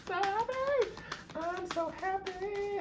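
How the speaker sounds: very high-pitched squeal of happiness; excited squeal. Real, not acted. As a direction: squeal it, drawing out the last word. Overflowing joy, repeated as squeal.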